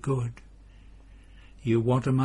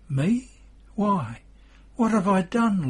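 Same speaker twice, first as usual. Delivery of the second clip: startled protest; 8 semitones higher, 4 dB louder than usual. Acted. Peter thinks he is scolded.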